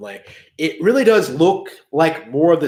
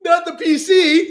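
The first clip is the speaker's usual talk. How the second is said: mock despair; 13 semitones higher than usual. Mock wail over PC port.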